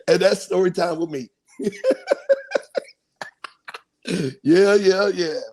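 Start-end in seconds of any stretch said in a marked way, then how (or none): 1.6-2.8 very high-pitched real laugh; high giggle. Real, not acted. Laughs closing the story.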